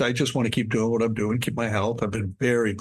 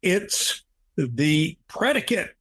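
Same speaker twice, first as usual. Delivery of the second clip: slow, drawn-out mock yell at a higher pitch; startled awake, sitting bolt upright in bed; retold yell; 5 semitones higher, much slower than usual. Sitting bolt upright in bed, blurting it out in a slow mock yell. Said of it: "and yelled".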